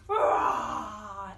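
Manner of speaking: low, loud mock lion roar. Storyteller roars as the lion for the children.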